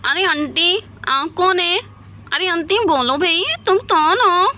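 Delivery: playing a little child, sing-song and giggly; childlike talk. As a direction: prattle like a small kid teasing an auntie. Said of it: "Laila यहाँ पर बिल्कुल बच्चों की तरह बातें करने". (बच्चों की तरह बोलते हुए)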